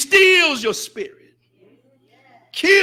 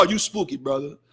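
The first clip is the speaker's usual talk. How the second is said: much softer and lower mocking jeer; mock scoffing brother; 9 semitones lower, 8 dB quieter than usual. Preacher imitating Muslims brushing off strictness, soft scoffing. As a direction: tease like a dismissive brother. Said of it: "we have the".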